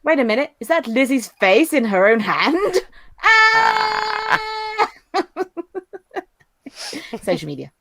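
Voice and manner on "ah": high laughing scream; laughing shriek. Real, not acted. Shocked by the face in her friend's hand, laughing shriek. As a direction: shriek in mock horror through laughter.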